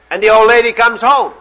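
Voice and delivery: ordinary talk, nothing marked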